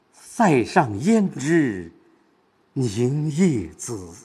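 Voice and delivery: 凝夜紫 drawn out very slowly with weight; pause, then let 凝夜紫 sink slowly inward; inward stress on 凝夜紫. Shown as a demonstration. Teacher modelling a tragic war line, heavy slow stress on 凝夜紫. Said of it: "这个沉重感和惨烈感呢".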